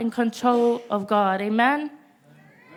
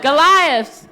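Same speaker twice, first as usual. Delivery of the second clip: loud, raised preacher's call; call-and-response shout; 4 semitones higher, 7 dB louder than usual. Real, not acted. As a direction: call it out to rouse the congregation. Repeated call to the congregation.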